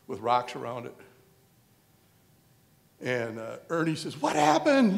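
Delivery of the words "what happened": high, loud imitated cry; mock alarmed question. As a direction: blurt it in a put-on high voice. Ernie's alarmed question, retold.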